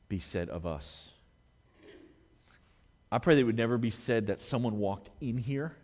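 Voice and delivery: hushed, earnest plea; sincere. Acted. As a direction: draw them in, low and intimate. Quiet earnest appeal to church.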